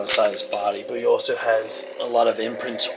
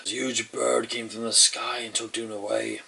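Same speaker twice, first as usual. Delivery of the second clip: usual speaking voice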